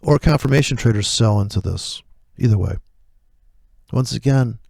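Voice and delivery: ordinary talk, nothing marked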